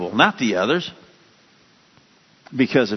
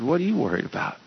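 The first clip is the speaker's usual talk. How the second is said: softer, calm reassurance; calm Jesus; 2 semitones higher, 6 dB quieter, faster than usual. Retold or imitated. Jesus calming the frightened disciples, soft and calm. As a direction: ask it gently, unbothered. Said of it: "He said".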